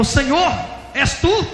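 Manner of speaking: high, loud, rising question; startled questioning. Retold or imitated. Pastor asking if it is God, high startled question. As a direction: call upward, unsure who is there.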